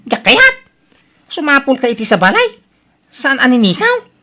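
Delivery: high, sharp, incredulous; exasperated protest. Acted. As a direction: fling the questions back at her. Daughter protesting to mother.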